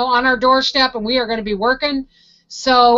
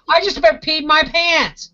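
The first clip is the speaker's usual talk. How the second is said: ordinary delivery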